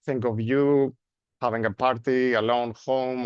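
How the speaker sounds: normal talking voice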